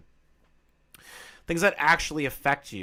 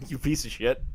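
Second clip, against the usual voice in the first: ordinary talk, nothing marked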